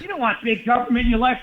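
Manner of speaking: high, mock campaign pitch; politician slogan. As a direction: sell it like a stump speech. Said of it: "the other person just gets up there".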